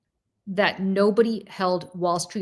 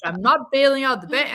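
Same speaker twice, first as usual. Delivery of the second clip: higher-pitched mock Bernie, a bit put on; a half-hearted Bernie Sanders imitation; Bernie impression; 6 semitones higher than usual. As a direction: try out Bernie's indignant voice. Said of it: "I can't do it".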